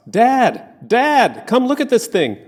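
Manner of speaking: high, loud excited yelling across the distance; excited shouts. Retold or imitated. Teenage sons shouting for their father down the road, excited yell. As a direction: call out to Dad like thrilled teens. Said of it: "The sound of their excited shouts".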